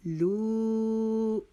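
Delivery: لو with the vowel held four alif long; long madd on lo. Shown as a demonstration. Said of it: "اس کو ہم نے چار الف، الف کے جتنا لمبا کیا". (لو کو چار الف کے برابر کھینچ کر پڑھیں)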